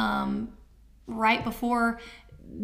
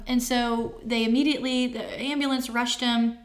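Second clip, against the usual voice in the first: usual speaking voice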